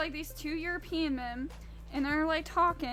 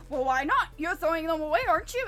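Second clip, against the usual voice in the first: demanding customer; 4 semitones higher, 7 dB louder, faster than usual. Retold or imitated. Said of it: "the guy's like".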